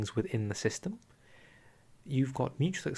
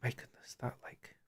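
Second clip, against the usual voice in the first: whispering; 10 dB quieter than usual. Real, not acted. Stunned reaction after long reading.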